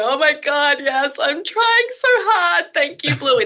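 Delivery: high, slow, overwhelmed parent gushing; a tired parent near tears, thanking the show; mock tearful parent. Parent watching Bluey, mock tearful gratitude.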